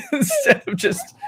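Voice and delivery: words broken by a high laugh; squeaky laugh mid-sentence. Real, not acted. Laughing at mom's fast-talking relatives.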